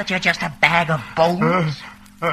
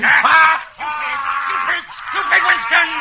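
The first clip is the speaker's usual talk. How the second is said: exploding in contempt, rising to a high yell; a torturer losing patience with his victim; furious shout; 11 semitones higher, 5 dB louder than usual. Acted. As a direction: spit out each word in scorn. Interrogator erupts in scorn.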